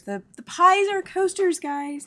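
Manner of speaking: high, loud burst of delight; excited discovery. Real, not acted. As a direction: announce it like a thrilling discovery. Excitedly announces the coaster discovery.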